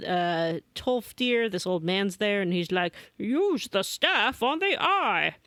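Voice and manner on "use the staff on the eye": put-on high, slow urgent old man call; hammed-up old mentor pressing the hero. Old man urges the player to act, hammed-up high call.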